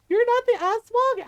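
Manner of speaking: put-on high, loud little-boy voice; scared bewilderment. Little brother facing his mom after the fright, bewildered. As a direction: imitate a shaken child working it out.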